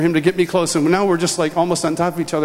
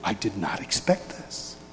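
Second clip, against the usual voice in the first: stunned aside; 10 semitones lower, 10 dB quieter than usual. Retold or imitated. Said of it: "And I'm going".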